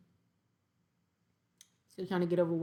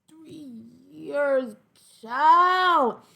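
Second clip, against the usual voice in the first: high, loud disbelieving exclamation; exclaiming; 7 semitones higher, 7 dB louder, much slower than usual. Real, not acted. Reacting to three wasted years.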